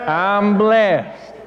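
a light take on a friend's contented voice; John Poole. Retold or imitated. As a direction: drawl out blessed like the friend. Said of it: "That's my best John Poole impersonation".

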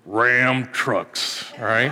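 low cowboy voice-over for a truck ad; a hint of a Western truck commercial; lightly done cowboy announcer; Sam Elliott voice. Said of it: "That's my best, uh, Sam Elliott impersonation".

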